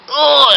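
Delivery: loud high "oh" of disgust; disgusted crowd groan. Acted. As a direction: recoil loudly from a stink. Crowd recoils from stinking fart.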